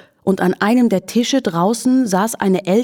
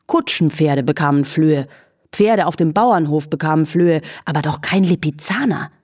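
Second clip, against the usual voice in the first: ordinary delivery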